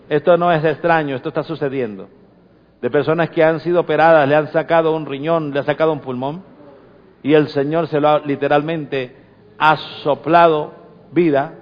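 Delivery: ordinary delivery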